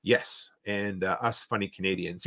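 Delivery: normal talking voice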